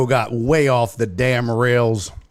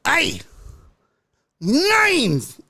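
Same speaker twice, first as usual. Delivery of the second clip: mock exclamation; 12 semitones higher than usual. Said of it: "It'll be like".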